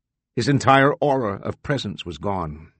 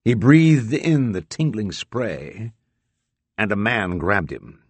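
plain reading voice

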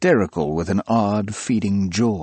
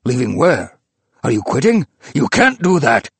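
rising louder alarm; alarmed old man; 4 semitones higher, 5 dB louder than usual. A narrator's reading. Old man to professor on phone, rising alarmed protest. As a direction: protest in growing panic.

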